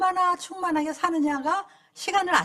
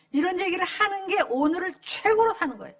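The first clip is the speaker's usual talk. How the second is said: ordinary talk, nothing marked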